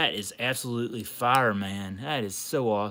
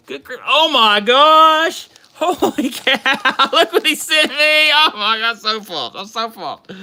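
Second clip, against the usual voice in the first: high, loud, stretched squeals with a laugh; can't contain the joy, let it burst; overjoyed squeal; 11 semitones higher, 11 dB louder, slower than usual. Real, not acted. Opening a friend's gift box, loud high overjoyed squealing.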